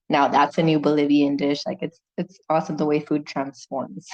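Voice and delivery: ordinary delivery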